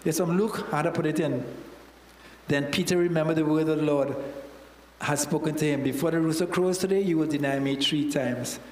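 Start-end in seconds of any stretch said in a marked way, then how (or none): none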